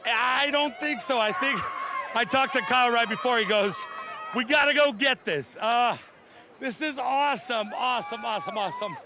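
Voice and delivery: loud, quick, joyful rush right after the win; bursting with real excitement; overjoyed. Real, not acted. Winning owner tells the interviewer about the win, loud and overjoyed. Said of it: "I'm a little emotional".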